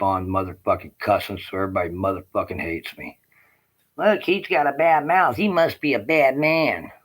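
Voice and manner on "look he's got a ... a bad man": high sneering put-on voice of the judgmental people; mocking the neighbours. Man mocks people calling him bad, high sneering voice. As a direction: sneer it like the gossips who judge him.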